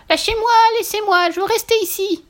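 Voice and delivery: very high, loud squealing protest; screaming piglet. A narrator's reading. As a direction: squeal at full force like a struggling piglet. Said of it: "criait de toutes ses forces". (cri suraigu de petit cochon qui se débat)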